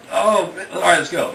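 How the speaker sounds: high, loud resigned sigh of surrender; reluctant give-in. Retold or imitated. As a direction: give in against your will. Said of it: "but he's like".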